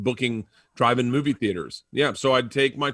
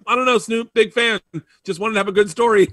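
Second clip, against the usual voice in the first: flustered excuse; 7 semitones higher, 4 dB louder than usual. Retold or imitated. Flustered excuse to [name], retold.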